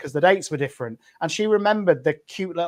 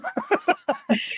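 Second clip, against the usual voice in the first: high burst of real laughter. Real, not acted. Laughing with a co-host about mum's accent. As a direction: laugh it off, amused. Laugh after retold reaction.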